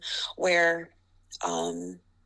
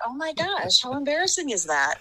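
embarrassed inner voice; 7 semitones higher than usual. Retold or imitated. Embarrassed thought after heckle.

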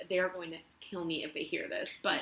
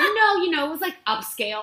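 much higher and louder, pushing the claim; insistent bluff; 10 semitones higher, 10 dB louder than usual. Retold or imitated. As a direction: oversell it, high and loud. Bluffing in interview, repeated.

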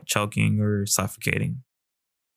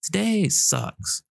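high, slow mock complaint; frustrated complaint; 11 semitones higher, slower than usual. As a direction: whine it out, fed up. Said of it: "I want you to be like".

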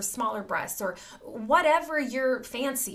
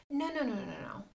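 soft, falling string of no's; quietly head the child off; hushed, quick little correction; gentle parental no; 8 dB quieter, faster than usual. Acted. Acted parent gently stopping a child.

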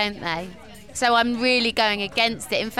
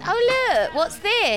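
surprised; 9 semitones higher, 4 dB louder, slower than usual. Real, not acted. Spots plate of snacks.

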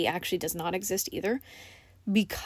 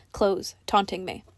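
ordinary delivery

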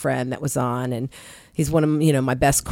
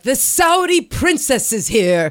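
announcing guest; 8 semitones higher, 4 dB louder, slower than usual. Retold or imitated. Coworker announces VIP guest.

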